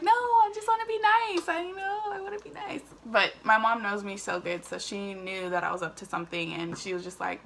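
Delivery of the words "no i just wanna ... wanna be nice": high, sweet, feigned innocence; coaxing a suspicious mom with fake innocence; innocent act. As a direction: play it coy to hide the secret.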